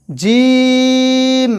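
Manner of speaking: জিম held long and even; drawn-out recitation. Shown as a demonstration. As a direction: stretch জিম out for students to repeat. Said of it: "আমি যে বলবো, আমার সাথে সাথে বলার". (জিম টেনে লম্বা করে বলা)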